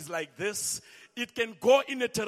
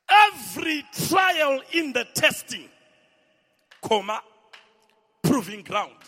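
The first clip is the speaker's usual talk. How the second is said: preaching at full force; 4 semitones higher, 9 dB louder than usual. Acted. Pastor proclaiming God's testing to the church, shouting. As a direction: thunder each phrase out.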